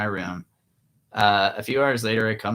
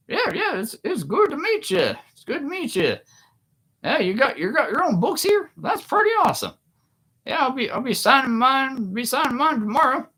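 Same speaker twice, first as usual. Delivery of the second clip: much higher, frog puppet voice; Kermit the Frog; 4 dB louder than usual. Retold or imitated. Famous author greeting a starstruck fan, in a much higher frog puppet voice. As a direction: imitate a Kermit-like voice all the way through. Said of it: "he sounds like Kermit the Frog".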